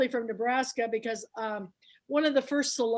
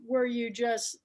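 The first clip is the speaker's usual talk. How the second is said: ordinary delivery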